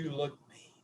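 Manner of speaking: low and soft, trailing away; subdued retold remark. Retelling player's grave remark.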